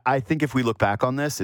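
ordinary talk, nothing marked

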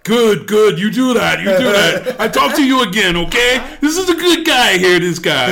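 loud, high-pitched boisterous send-off; mock booming endorsement for all to hear; imitated hearty approval. Tom's loud approving farewell.

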